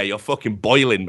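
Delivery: usual speaking voice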